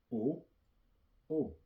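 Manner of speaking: O said loud and rounded, twice; demo French O. Shown as a demonstration. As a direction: model the O sound clearly. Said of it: "So O".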